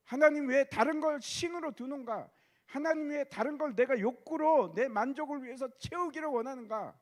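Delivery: full-force pulpit preaching; fervent preaching. Acted. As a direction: drive the questions home to the congregation.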